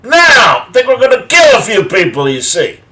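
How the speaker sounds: high, loud mock tough-guy threat; Dirty Harry menace. Joking about the show to the room, hammed-up tough-guy shout. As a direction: ham up a movie cop's threat. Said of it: "much more Dirty Harry than it is I Spy".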